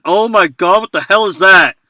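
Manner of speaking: loud and high, taken aback; shocked. Real, not acted. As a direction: blurt it out in disbelief. Shocked by the transcription.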